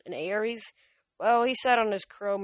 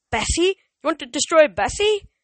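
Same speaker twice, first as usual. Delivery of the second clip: much higher, loud and stunned; horrified disbelief; 9 semitones higher, 12 dB louder than usual. A narrator's reading. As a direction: protect your friend in shock. Percy horrified about his friend.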